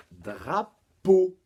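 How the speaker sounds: drapeau high and loud, broken before peau; syllable by syllable. Shown as a demonstration. Teacher announces the rhyme word, drapeau split loudly.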